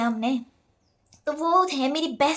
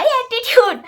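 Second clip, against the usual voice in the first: Attitude thrown out much higher and louder, falling; hammed-up proud declaration; loud mock attitude; 10 semitones higher, 9 dB louder, slower than usual. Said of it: "attitude भाई". (ज़ोर से ऊँचा, नखरे भरा attitude)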